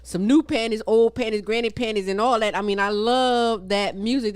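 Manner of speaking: loud, high laughing riff; excited riff. Real, not acted. Host riffing to co-host about the song, loud and high. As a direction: get carried away joking.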